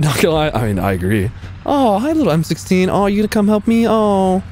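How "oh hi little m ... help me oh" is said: very high-pitched, doting coo; playful falsetto cooing; cooing baby talk. Pretend. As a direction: gush over a tiny cute pet in a squeaky voice. Coos at a cute little M16.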